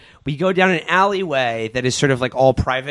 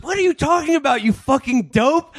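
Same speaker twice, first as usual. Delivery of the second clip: high, angry retort pitched way up; pitched-up mock fury at a smug stranger; furious retort; 11 semitones higher than usual. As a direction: snap back at him in a mock fury.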